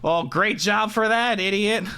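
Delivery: lightly voiced gruff leader; sarcastic leader.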